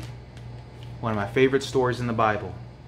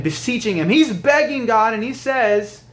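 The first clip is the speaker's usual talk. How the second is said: high, loud preaching, slowing down; preaching at full force; 10 semitones higher, 6 dB louder than usual. Acted. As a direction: drive the story home to the congregation.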